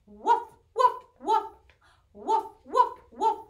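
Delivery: short, loud barked woofs; loud puppy barks. Acted. Teacher barking along as the puppy, loud. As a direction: bark like a happy puppy.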